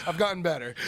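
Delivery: high laughing line. Real, not acted. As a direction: say it through a laugh. Laughing after being called out.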